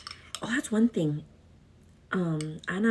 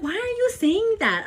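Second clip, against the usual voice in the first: scandalized, much higher and louder; high, loud mock outrage; 12 semitones higher, 6 dB louder than usual. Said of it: "I'm like".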